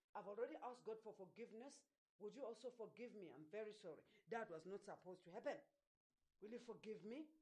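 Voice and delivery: soft, low, subdued apology; gentle apology. Acted. Preacher models a parent apologizing to their child, gentle apology. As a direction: say sorry quietly and sincerely.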